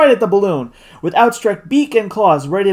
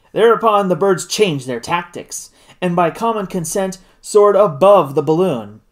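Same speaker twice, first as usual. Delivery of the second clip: ordinary delivery, nothing marked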